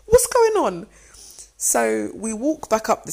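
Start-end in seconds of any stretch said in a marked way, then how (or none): 0.1-0.9 high, loud baffled demand; squeaky, loud mock outrage; retold bewilderment. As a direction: act out alarmed confusion. Retold indignant question to fiancé.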